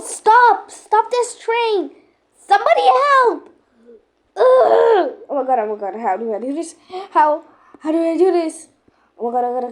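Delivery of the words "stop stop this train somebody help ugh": high, loud cries for help; panicked yelling. Pretend. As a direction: scream for someone to stop the train. Passenger panics on runaway train.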